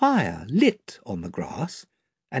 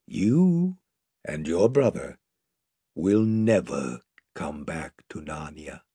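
lower, soft, tender; very gently; 8 semitones lower, 4 dB quieter than usual. A narrator's reading. Aslan gently comforts Lucy, low and tender. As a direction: soothe her like a loving father. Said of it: "said Aslan very gently".

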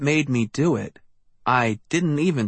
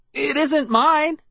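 high, slow, pleading; cried out; 13 semitones higher than usual. A narrator's reading. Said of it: "I cried".

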